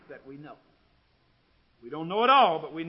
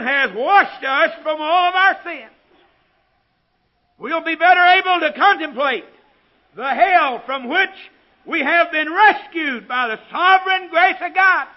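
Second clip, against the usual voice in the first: loud, high preacher's proclamation; ringing pulpit declaration at full volume; full-force preaching; 12 semitones higher, 18 dB louder than usual. Real, not acted.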